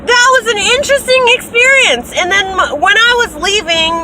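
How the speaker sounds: way up in pitch, loud and animated; breathless, high and loud storytelling; high excited rant. Real, not acted. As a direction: tell it bursting with disbelief.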